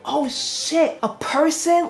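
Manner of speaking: high, startled disbelief; confused shock. Retold or imitated. Hero sees somebody crushed by poles, confused shock. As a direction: jump up in pitch, baffled. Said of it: "He was so confused".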